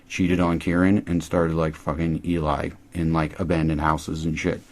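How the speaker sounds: ordinary talk, nothing marked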